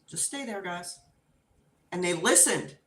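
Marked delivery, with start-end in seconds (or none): 0.1-1.0 very soft, careful instruction; hushed command. Retold or imitated. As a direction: imitate mom quietly settling the dogs. Mom softly telling dogs to stay.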